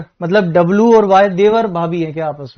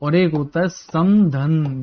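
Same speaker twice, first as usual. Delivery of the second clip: slow, deliberate term; 2 semitones lower, slower than usual. Shown as a demonstration. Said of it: "एक शब्द जानते हैं".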